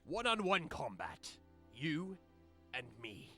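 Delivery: proud captain's challenge; pirate captain voice. Acted.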